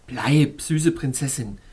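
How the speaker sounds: ordinary delivery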